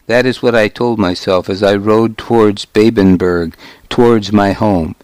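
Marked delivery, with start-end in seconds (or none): none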